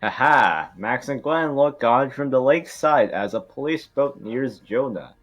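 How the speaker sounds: usual speaking voice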